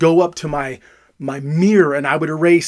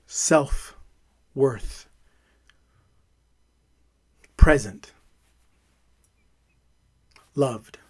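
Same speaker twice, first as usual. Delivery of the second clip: soft, lower and slow, each word alone; soft affirmations; 4 semitones lower, 8 dB quieter, much slower than usual. Real, not acted. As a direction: let each word land gently. Reads out affirmations after crying.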